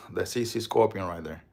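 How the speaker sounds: normal talking voice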